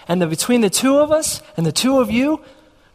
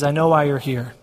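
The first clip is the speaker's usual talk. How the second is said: lower, softer, calm boss voice; imitated reassuring boss; 6 semitones lower, 4 dB quieter than usual. Boss releasing him to take the position, reassuring. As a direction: drop low and quiet to reassure, as the boss. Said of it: "and Brian says".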